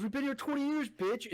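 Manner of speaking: high, gleeful mock comeback; imitating dad's retort. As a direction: fire back like the triumphant dad. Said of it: "my dad turns around and goes".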